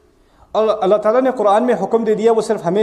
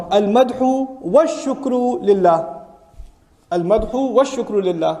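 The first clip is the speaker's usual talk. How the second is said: slow deliberate repetition; slower than usual. Shown as a demonstration. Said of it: "teacher repeating a phrase".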